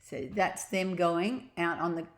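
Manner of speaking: plain reading voice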